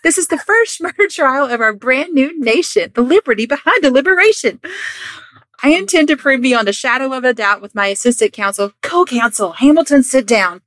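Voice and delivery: high, loud, hammed-up courtroom speech; theatrical trial lawyer. Lawyer declares client innocent before the jury, grandstanding.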